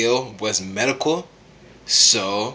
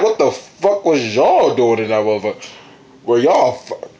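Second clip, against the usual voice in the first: higher teasing voice, hammed-up; mock Urahara; 4 semitones higher than usual. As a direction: tease him like a nosy friend. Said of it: "And Urahara like".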